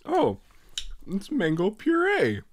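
ordinary delivery

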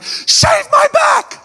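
very high, squeaky slow shout; Fo's voice. Retold or imitated. Big brother right in my face, absurdly high shouted demand. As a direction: screech it way up high, stretching each word. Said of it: "that's Fo's voice".

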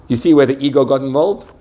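ordinary delivery

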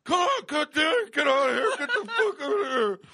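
loud, high, frantic shouting; half-asleep panic. Retold or imitated. Man acting out a chimp nightmare, panicked shouting. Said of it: "half in and out of sleep going".

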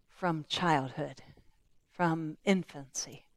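slow, soft and low, heavy-hearted; weary father. Acted. A father describing his son's lifelong suffering, slow and soft. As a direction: let the father's grief slow him right down. Father answers Jesus about son.

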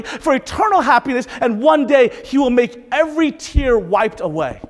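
high, loud, fervent proclamation; impassioned preaching. Real, not acted. Preacher promising hope in Jesus, impassioned preaching. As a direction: lift every phrase like a promise to the crowd.

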